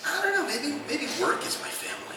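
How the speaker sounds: high soft daydreaming aside; wistful. Retold or imitated. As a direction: float it up like a guilty daydream. People wistfully thinking.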